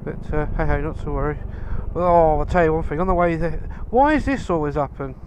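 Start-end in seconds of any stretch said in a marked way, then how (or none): none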